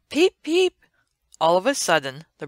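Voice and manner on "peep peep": high, louder peep peep; squeaky chick call. Acted. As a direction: chirp like a little chick. Chick peeping.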